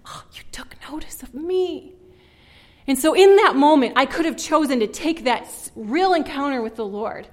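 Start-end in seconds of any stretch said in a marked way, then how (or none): 0.1-1.9 a breathy, moved whisper, pitched up. Retold or imitated. Girl overwhelmed before the Lord in the woods, whispering. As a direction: let it slip out as an awed whisper.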